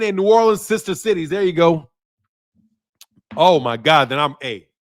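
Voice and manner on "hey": ordinary delivery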